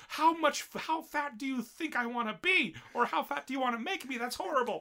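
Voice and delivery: put-on high, loud indignant girlish voice; whiny girl voice. Offended girl protesting to her friend, high indignant squeal. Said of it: "and she's like".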